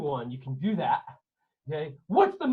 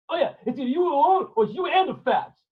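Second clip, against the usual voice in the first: bully him; menacing sailor; 7 semitones higher, 6 dB louder than usual. Acted. Gentile threat corrected.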